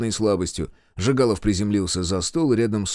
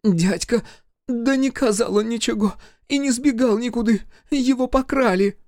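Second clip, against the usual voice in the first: village bride; 13 semitones higher than usual. Acted. Bride insists groom was stolen.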